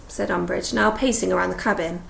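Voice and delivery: ordinary delivery, nothing marked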